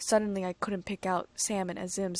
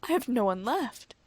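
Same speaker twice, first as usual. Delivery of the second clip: choked out; 4 semitones higher, 4 dB louder than usual. A narrator's reading. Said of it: "I choked out".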